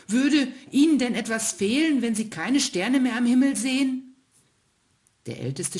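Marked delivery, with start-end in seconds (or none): 0.1-4.2 hoch und laut, trotzig fragend; trotzige Frage. Acted. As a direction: herausfordernd nachhaken wie ein bockiges Kind. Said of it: "meinte der kleine Stern trotzig". (kleiner Stern fragt den ältesten Stern trotzig)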